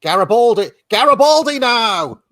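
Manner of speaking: loud, high commentator's cry; excited match-commentator yell, stretched out; mock football commentary. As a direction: shout it like a goal is coming.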